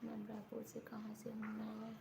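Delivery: soft whisper, reading off the screen. Real, not acted. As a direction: whisper the comment under your breath. Reading a viewer comment aloud.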